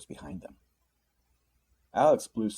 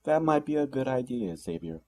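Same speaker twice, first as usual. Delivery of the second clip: smirking harumph; 6 semitones higher than usual. A narrator's reading. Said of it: "smirked and said with a harumph".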